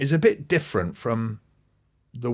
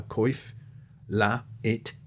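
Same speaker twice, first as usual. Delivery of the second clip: ordinary delivery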